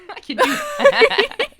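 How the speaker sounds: high, slow, squealing laugh through the words. Real, not acted. American co-writer cracking up at herself, laughing. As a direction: let the laugh lift it way up.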